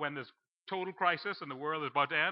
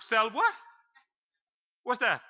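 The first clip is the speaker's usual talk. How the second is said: pitched up and louder, playing dumb about a strange new word; mock confusion; 7 semitones higher, 5 dB louder than usual. Preacher to the congregation, pretending never to have heard of a cell phone, mock baffled. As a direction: act baffled by the words.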